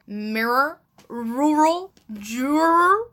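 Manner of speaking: rising, careful American r sounds; struggling with American accent; standard american accent. Shown as a demonstration. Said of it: "the hardest words to say in a North American accent are".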